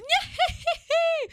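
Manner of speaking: real high-pitched burst of laughter; high squealing laugh. Real, not acted. Laughing about his new laugh, high squeal.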